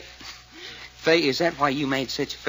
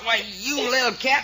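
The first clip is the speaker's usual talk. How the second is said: higher, heated snarl at her; angry snarl; 8 semitones higher than usual. Acted. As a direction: spit it at the woman fighting him. Man grappling with struggling woman.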